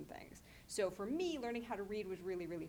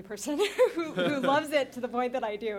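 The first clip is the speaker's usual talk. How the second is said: laughing through the words, louder; laughing at her own obsession; laughing while talking; 5 semitones higher, 9 dB louder than usual. Real, not acted. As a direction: giggle as you confess it. Gushing about loving Hagar.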